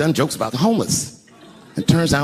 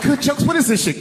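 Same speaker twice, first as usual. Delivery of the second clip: high, scornful disbelief; imitated outraged wife; 12 semitones higher, 3 dB louder than usual. Wife reading husband's joke book, imitated outrage.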